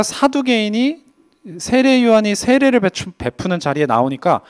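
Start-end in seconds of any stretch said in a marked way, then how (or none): none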